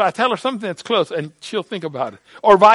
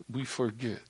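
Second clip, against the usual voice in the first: falling, much softer; quiet; 7 semitones lower, 14 dB quieter than usual. Real, not acted. Preacher softly reminding listeners, quiet. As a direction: let it sink in.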